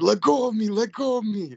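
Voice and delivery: high, loud yell of let go of me; a fighting patient's shrill protest; struggling patient's yell. Retold or imitated. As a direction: shout it while pinned down by four staff. Restrained patient yelling while held.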